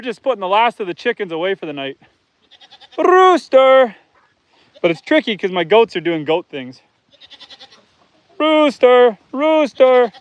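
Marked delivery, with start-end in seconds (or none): 3.0-4.0 loud, high call across the yard. Real, not acted. Farmer calling loudly to the rooster at bedtime. 8.4-10.1 loud, high call across the yard. Real, not acted. Farmer calling loudly to the rooster at bedtime.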